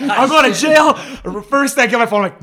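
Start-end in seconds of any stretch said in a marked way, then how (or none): 0.0-0.9 high, loud mock panic; hammed-up terror at getting caught; retold panic. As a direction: replay the freak-out, half laughing. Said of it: "Right away, I freaked out".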